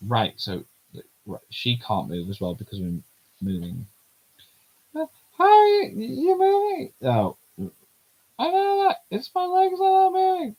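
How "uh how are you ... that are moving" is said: put-on high-pitched girly character voice; squeaky young girl voice.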